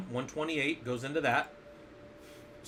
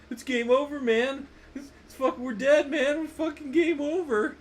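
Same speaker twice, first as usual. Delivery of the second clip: Bill Paxton impression; 11 semitones higher, 4 dB louder than usual. Teacher hams up a movie marine panicking for students, high frantic voice. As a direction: panicky high yelping "game over, man" voice, pitched way up. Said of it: "I was doing Bill Paxton".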